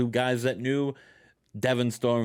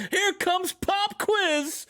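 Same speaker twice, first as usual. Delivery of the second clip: high, slow, drawn-out announcer call; ring announcer; much slower than usual. Retold or imitated. Announcing Pop Quiz's entrance, slow high announcer call. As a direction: stretch it like a hype announcer.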